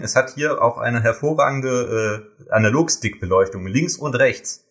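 ordinary talk, nothing marked

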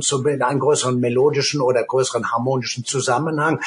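usual speaking voice

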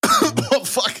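high laughing gasp after choking; recovering from choking. Real, not acted. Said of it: "an meiner eigenen Spucke gerade verschluckt".

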